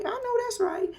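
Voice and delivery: I know that's right squeaked very high; high squeal. Retold or imitated. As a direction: squeal it up high like a hype girl. Repeated cheer, high second take.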